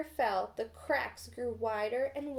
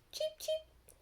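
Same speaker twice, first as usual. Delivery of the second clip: very high, very soft cheeps; tiny newborn chicks calling; peeping chicks; 6 dB quieter than usual. A narrator's reading. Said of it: "The chicks peeped".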